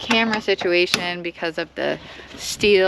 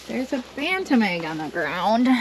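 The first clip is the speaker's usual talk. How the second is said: normal talking voice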